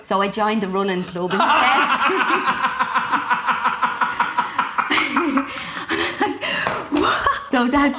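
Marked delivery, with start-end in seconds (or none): 1.5-7.5 long, helpless high laugh; big laugh. Real, not acted. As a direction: laugh hard at your own punchline. Laughing at her own punchline.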